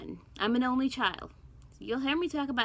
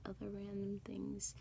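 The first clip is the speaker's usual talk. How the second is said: the same words whispered with no voice; 10 dB quieter than usual. Real, not acted. Vlogger repeats herself in a thinking whisper. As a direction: drop to a whisper while thinking.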